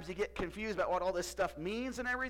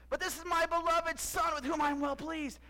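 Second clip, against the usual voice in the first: high, louder divine proclamation; voice of God retry; 8 semitones higher, 4 dB louder than usual. Shown as a demonstration. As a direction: try once more to sound heavenly. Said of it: "I messed it up".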